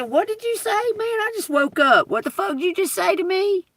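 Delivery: high, hammed-up outraged yell; mock outrage. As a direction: act out a high mocking fury at him. Retold angry reply, pitch jump.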